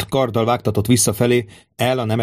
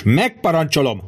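high-pitched, emphatic command; narrated roar; 6 semitones higher than usual. A narrator's reading. Tribune commands the centurion, acted roar. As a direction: pull rank with a raised voice. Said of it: "ordította a tribunus".

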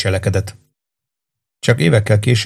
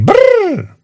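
put-on high toddler's toy-truck brrr; little boy buzzing his lips like an engine; engine noise; 3 dB louder than usual. Toddler plays with fire truck, high buzzing brrr. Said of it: "mondta Frankie".

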